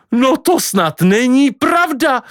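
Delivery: high, drawn-out outraged protest; shrieking. Acted. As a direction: protest in a hammed-up high shriek, no full scream. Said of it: "ječel Kvido". (rozhořčené ječení)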